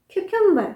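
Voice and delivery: क्यूकम्बर high, then falling; Y glide pronunciation. Shown as a demonstration. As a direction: say क्यूकम्बर as "KYOO-kum-ber". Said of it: "थोड़ा style में बोलिए".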